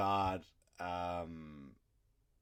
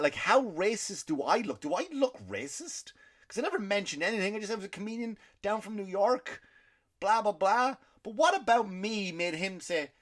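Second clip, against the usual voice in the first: higher, incredulous rant; pitched up in outraged confusion; asking why he'd think that, higher and incredulous; indignant disbelief; 10 semitones higher than usual. Real, not acted. Outraged over the dealer's remark.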